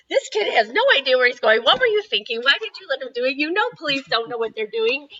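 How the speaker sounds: a mother's loud alarm at her own choice; panicked worry. Retold or imitated. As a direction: blurt it out in a rush of panic. Mom panicking over missing son.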